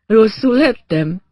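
slowly ask, lightly voicing a dazed patient; dazed man coming round.

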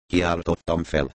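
ordinary delivery

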